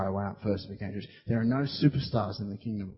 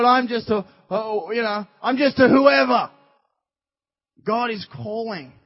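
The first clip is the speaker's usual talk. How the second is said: loud, high-pitched preacher delivery; impassioned sermon, voice raised; full-force preaching; 7 semitones higher, 6 dB louder than usual. Acted. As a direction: preach it out to a crowd, acting out the doubters and answers.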